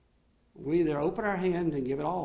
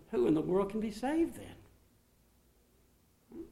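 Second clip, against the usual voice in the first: astonished question; 6 semitones higher, much slower than usual. Retold or imitated. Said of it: "they were astonished".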